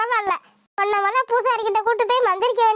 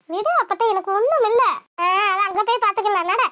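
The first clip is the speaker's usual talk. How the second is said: ordinary talk, nothing marked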